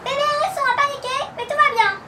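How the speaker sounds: high, far-carrying call; shouting across. A narrator's reading. Mum shouts back across the road, high call. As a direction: call out with all her little lungs. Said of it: "hurla de tous ses petits poumons".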